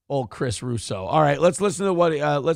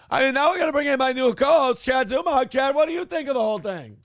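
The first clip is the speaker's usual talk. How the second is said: pitched-up mock host voice; Kevin Brennan; 8 semitones higher than usual. Mock host introducing his new co-host, high-voiced impression. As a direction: play the host bringing on a sidekick. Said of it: "Mad Dog Russo is like sports Kevin Brennan".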